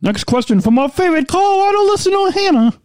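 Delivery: high, bright announcer sing-song; radio voice. Pretend. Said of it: "my radio voice?".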